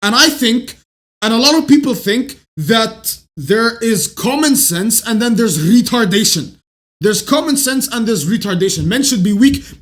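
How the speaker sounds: normal talking voice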